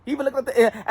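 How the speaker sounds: usual speaking voice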